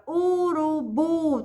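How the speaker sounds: "urubu" slowly, stretched into u-ru-bu; syllable by syllable. Shown as a demonstration. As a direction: draw out each syllable of "urubu". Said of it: "a última sílaba é a sílaba tônica". ("urubu" devagar, alongando as sílabas)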